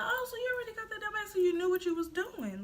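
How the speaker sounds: high, fast imitation of a questioning relative; imitated aunt. Aunt questioning her pregnant niece, imitated in a high voice. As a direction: put on an aunt catching someone out. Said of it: "She's like".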